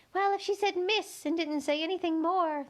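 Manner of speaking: high, thin little insect voice; a tiny gnat piping up to Alice. A narrator's reading. Gnat telling Alice where to look, high and thin.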